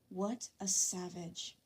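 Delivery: usual speaking voice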